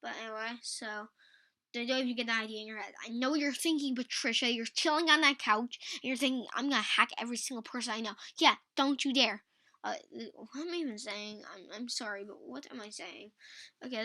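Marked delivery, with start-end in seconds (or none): 1.9-9.4 loud, playful telling-off; mock scolding. Host warns imagined viewer Patricia off hacking, mock scolding. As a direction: call out the would-be hacker, louder and punchy.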